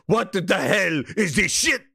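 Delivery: Herman impression. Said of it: "he would come in here and go".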